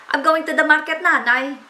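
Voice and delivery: lightly Baler-accented English to mother. Shown as a demonstration. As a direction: say it with the Baler lilt. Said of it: "yung accent ko is taga Baler".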